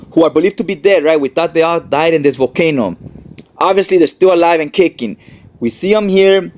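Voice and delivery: normal talking voice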